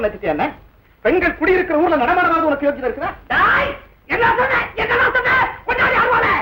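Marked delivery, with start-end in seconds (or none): 3.3-6.3 furious shout. Acted. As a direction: roar for the weapon in fury. Enraged, calls for a sickle.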